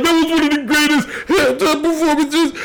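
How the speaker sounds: high, teary mock fan gush. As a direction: gush like an overwhelmed fan, high and near tears. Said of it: "and you're like".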